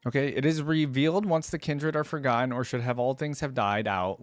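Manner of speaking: ordinary talk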